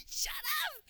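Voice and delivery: imitating mom, a very high stunned "shut up"; mom's amazed shriek. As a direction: squeal it like a stunned mother. Mom reacting to WikiLeaks news.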